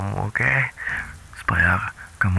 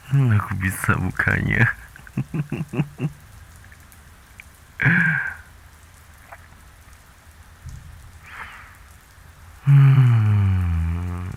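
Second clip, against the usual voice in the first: a sinister laugh that swells into a hum; unsettling laugh and drawn-out hum at the door; creepy laugh and hum; 3 dB louder, much slower than usual. Acted.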